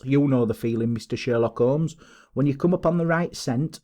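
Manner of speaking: plain reading voice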